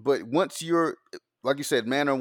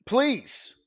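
eager mock send-off; 6 semitones higher, 6 dB louder than usual. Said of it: "my response is".

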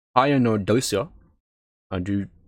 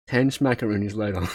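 usual speaking voice

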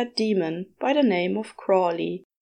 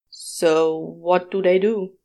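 lower, slow languid demon; Crawley's voice; 3 semitones lower, slower than usual. A narrator's reading. Crawley idly asks the angel about the humans. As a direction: drawl the question lazily.

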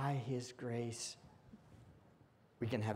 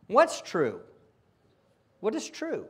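ordinary talk, nothing marked

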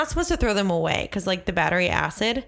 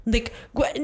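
ordinary talk

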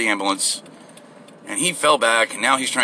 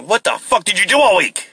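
high, loud mock outrage; imagined angry boss; 12 semitones higher, 6 dB louder than usual. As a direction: yell it like a furious boss. Imagines angry boss confronting him.

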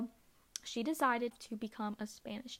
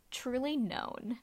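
usual speaking voice

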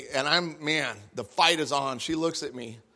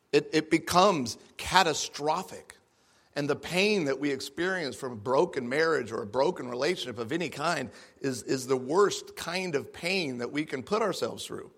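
ordinary talk, nothing marked

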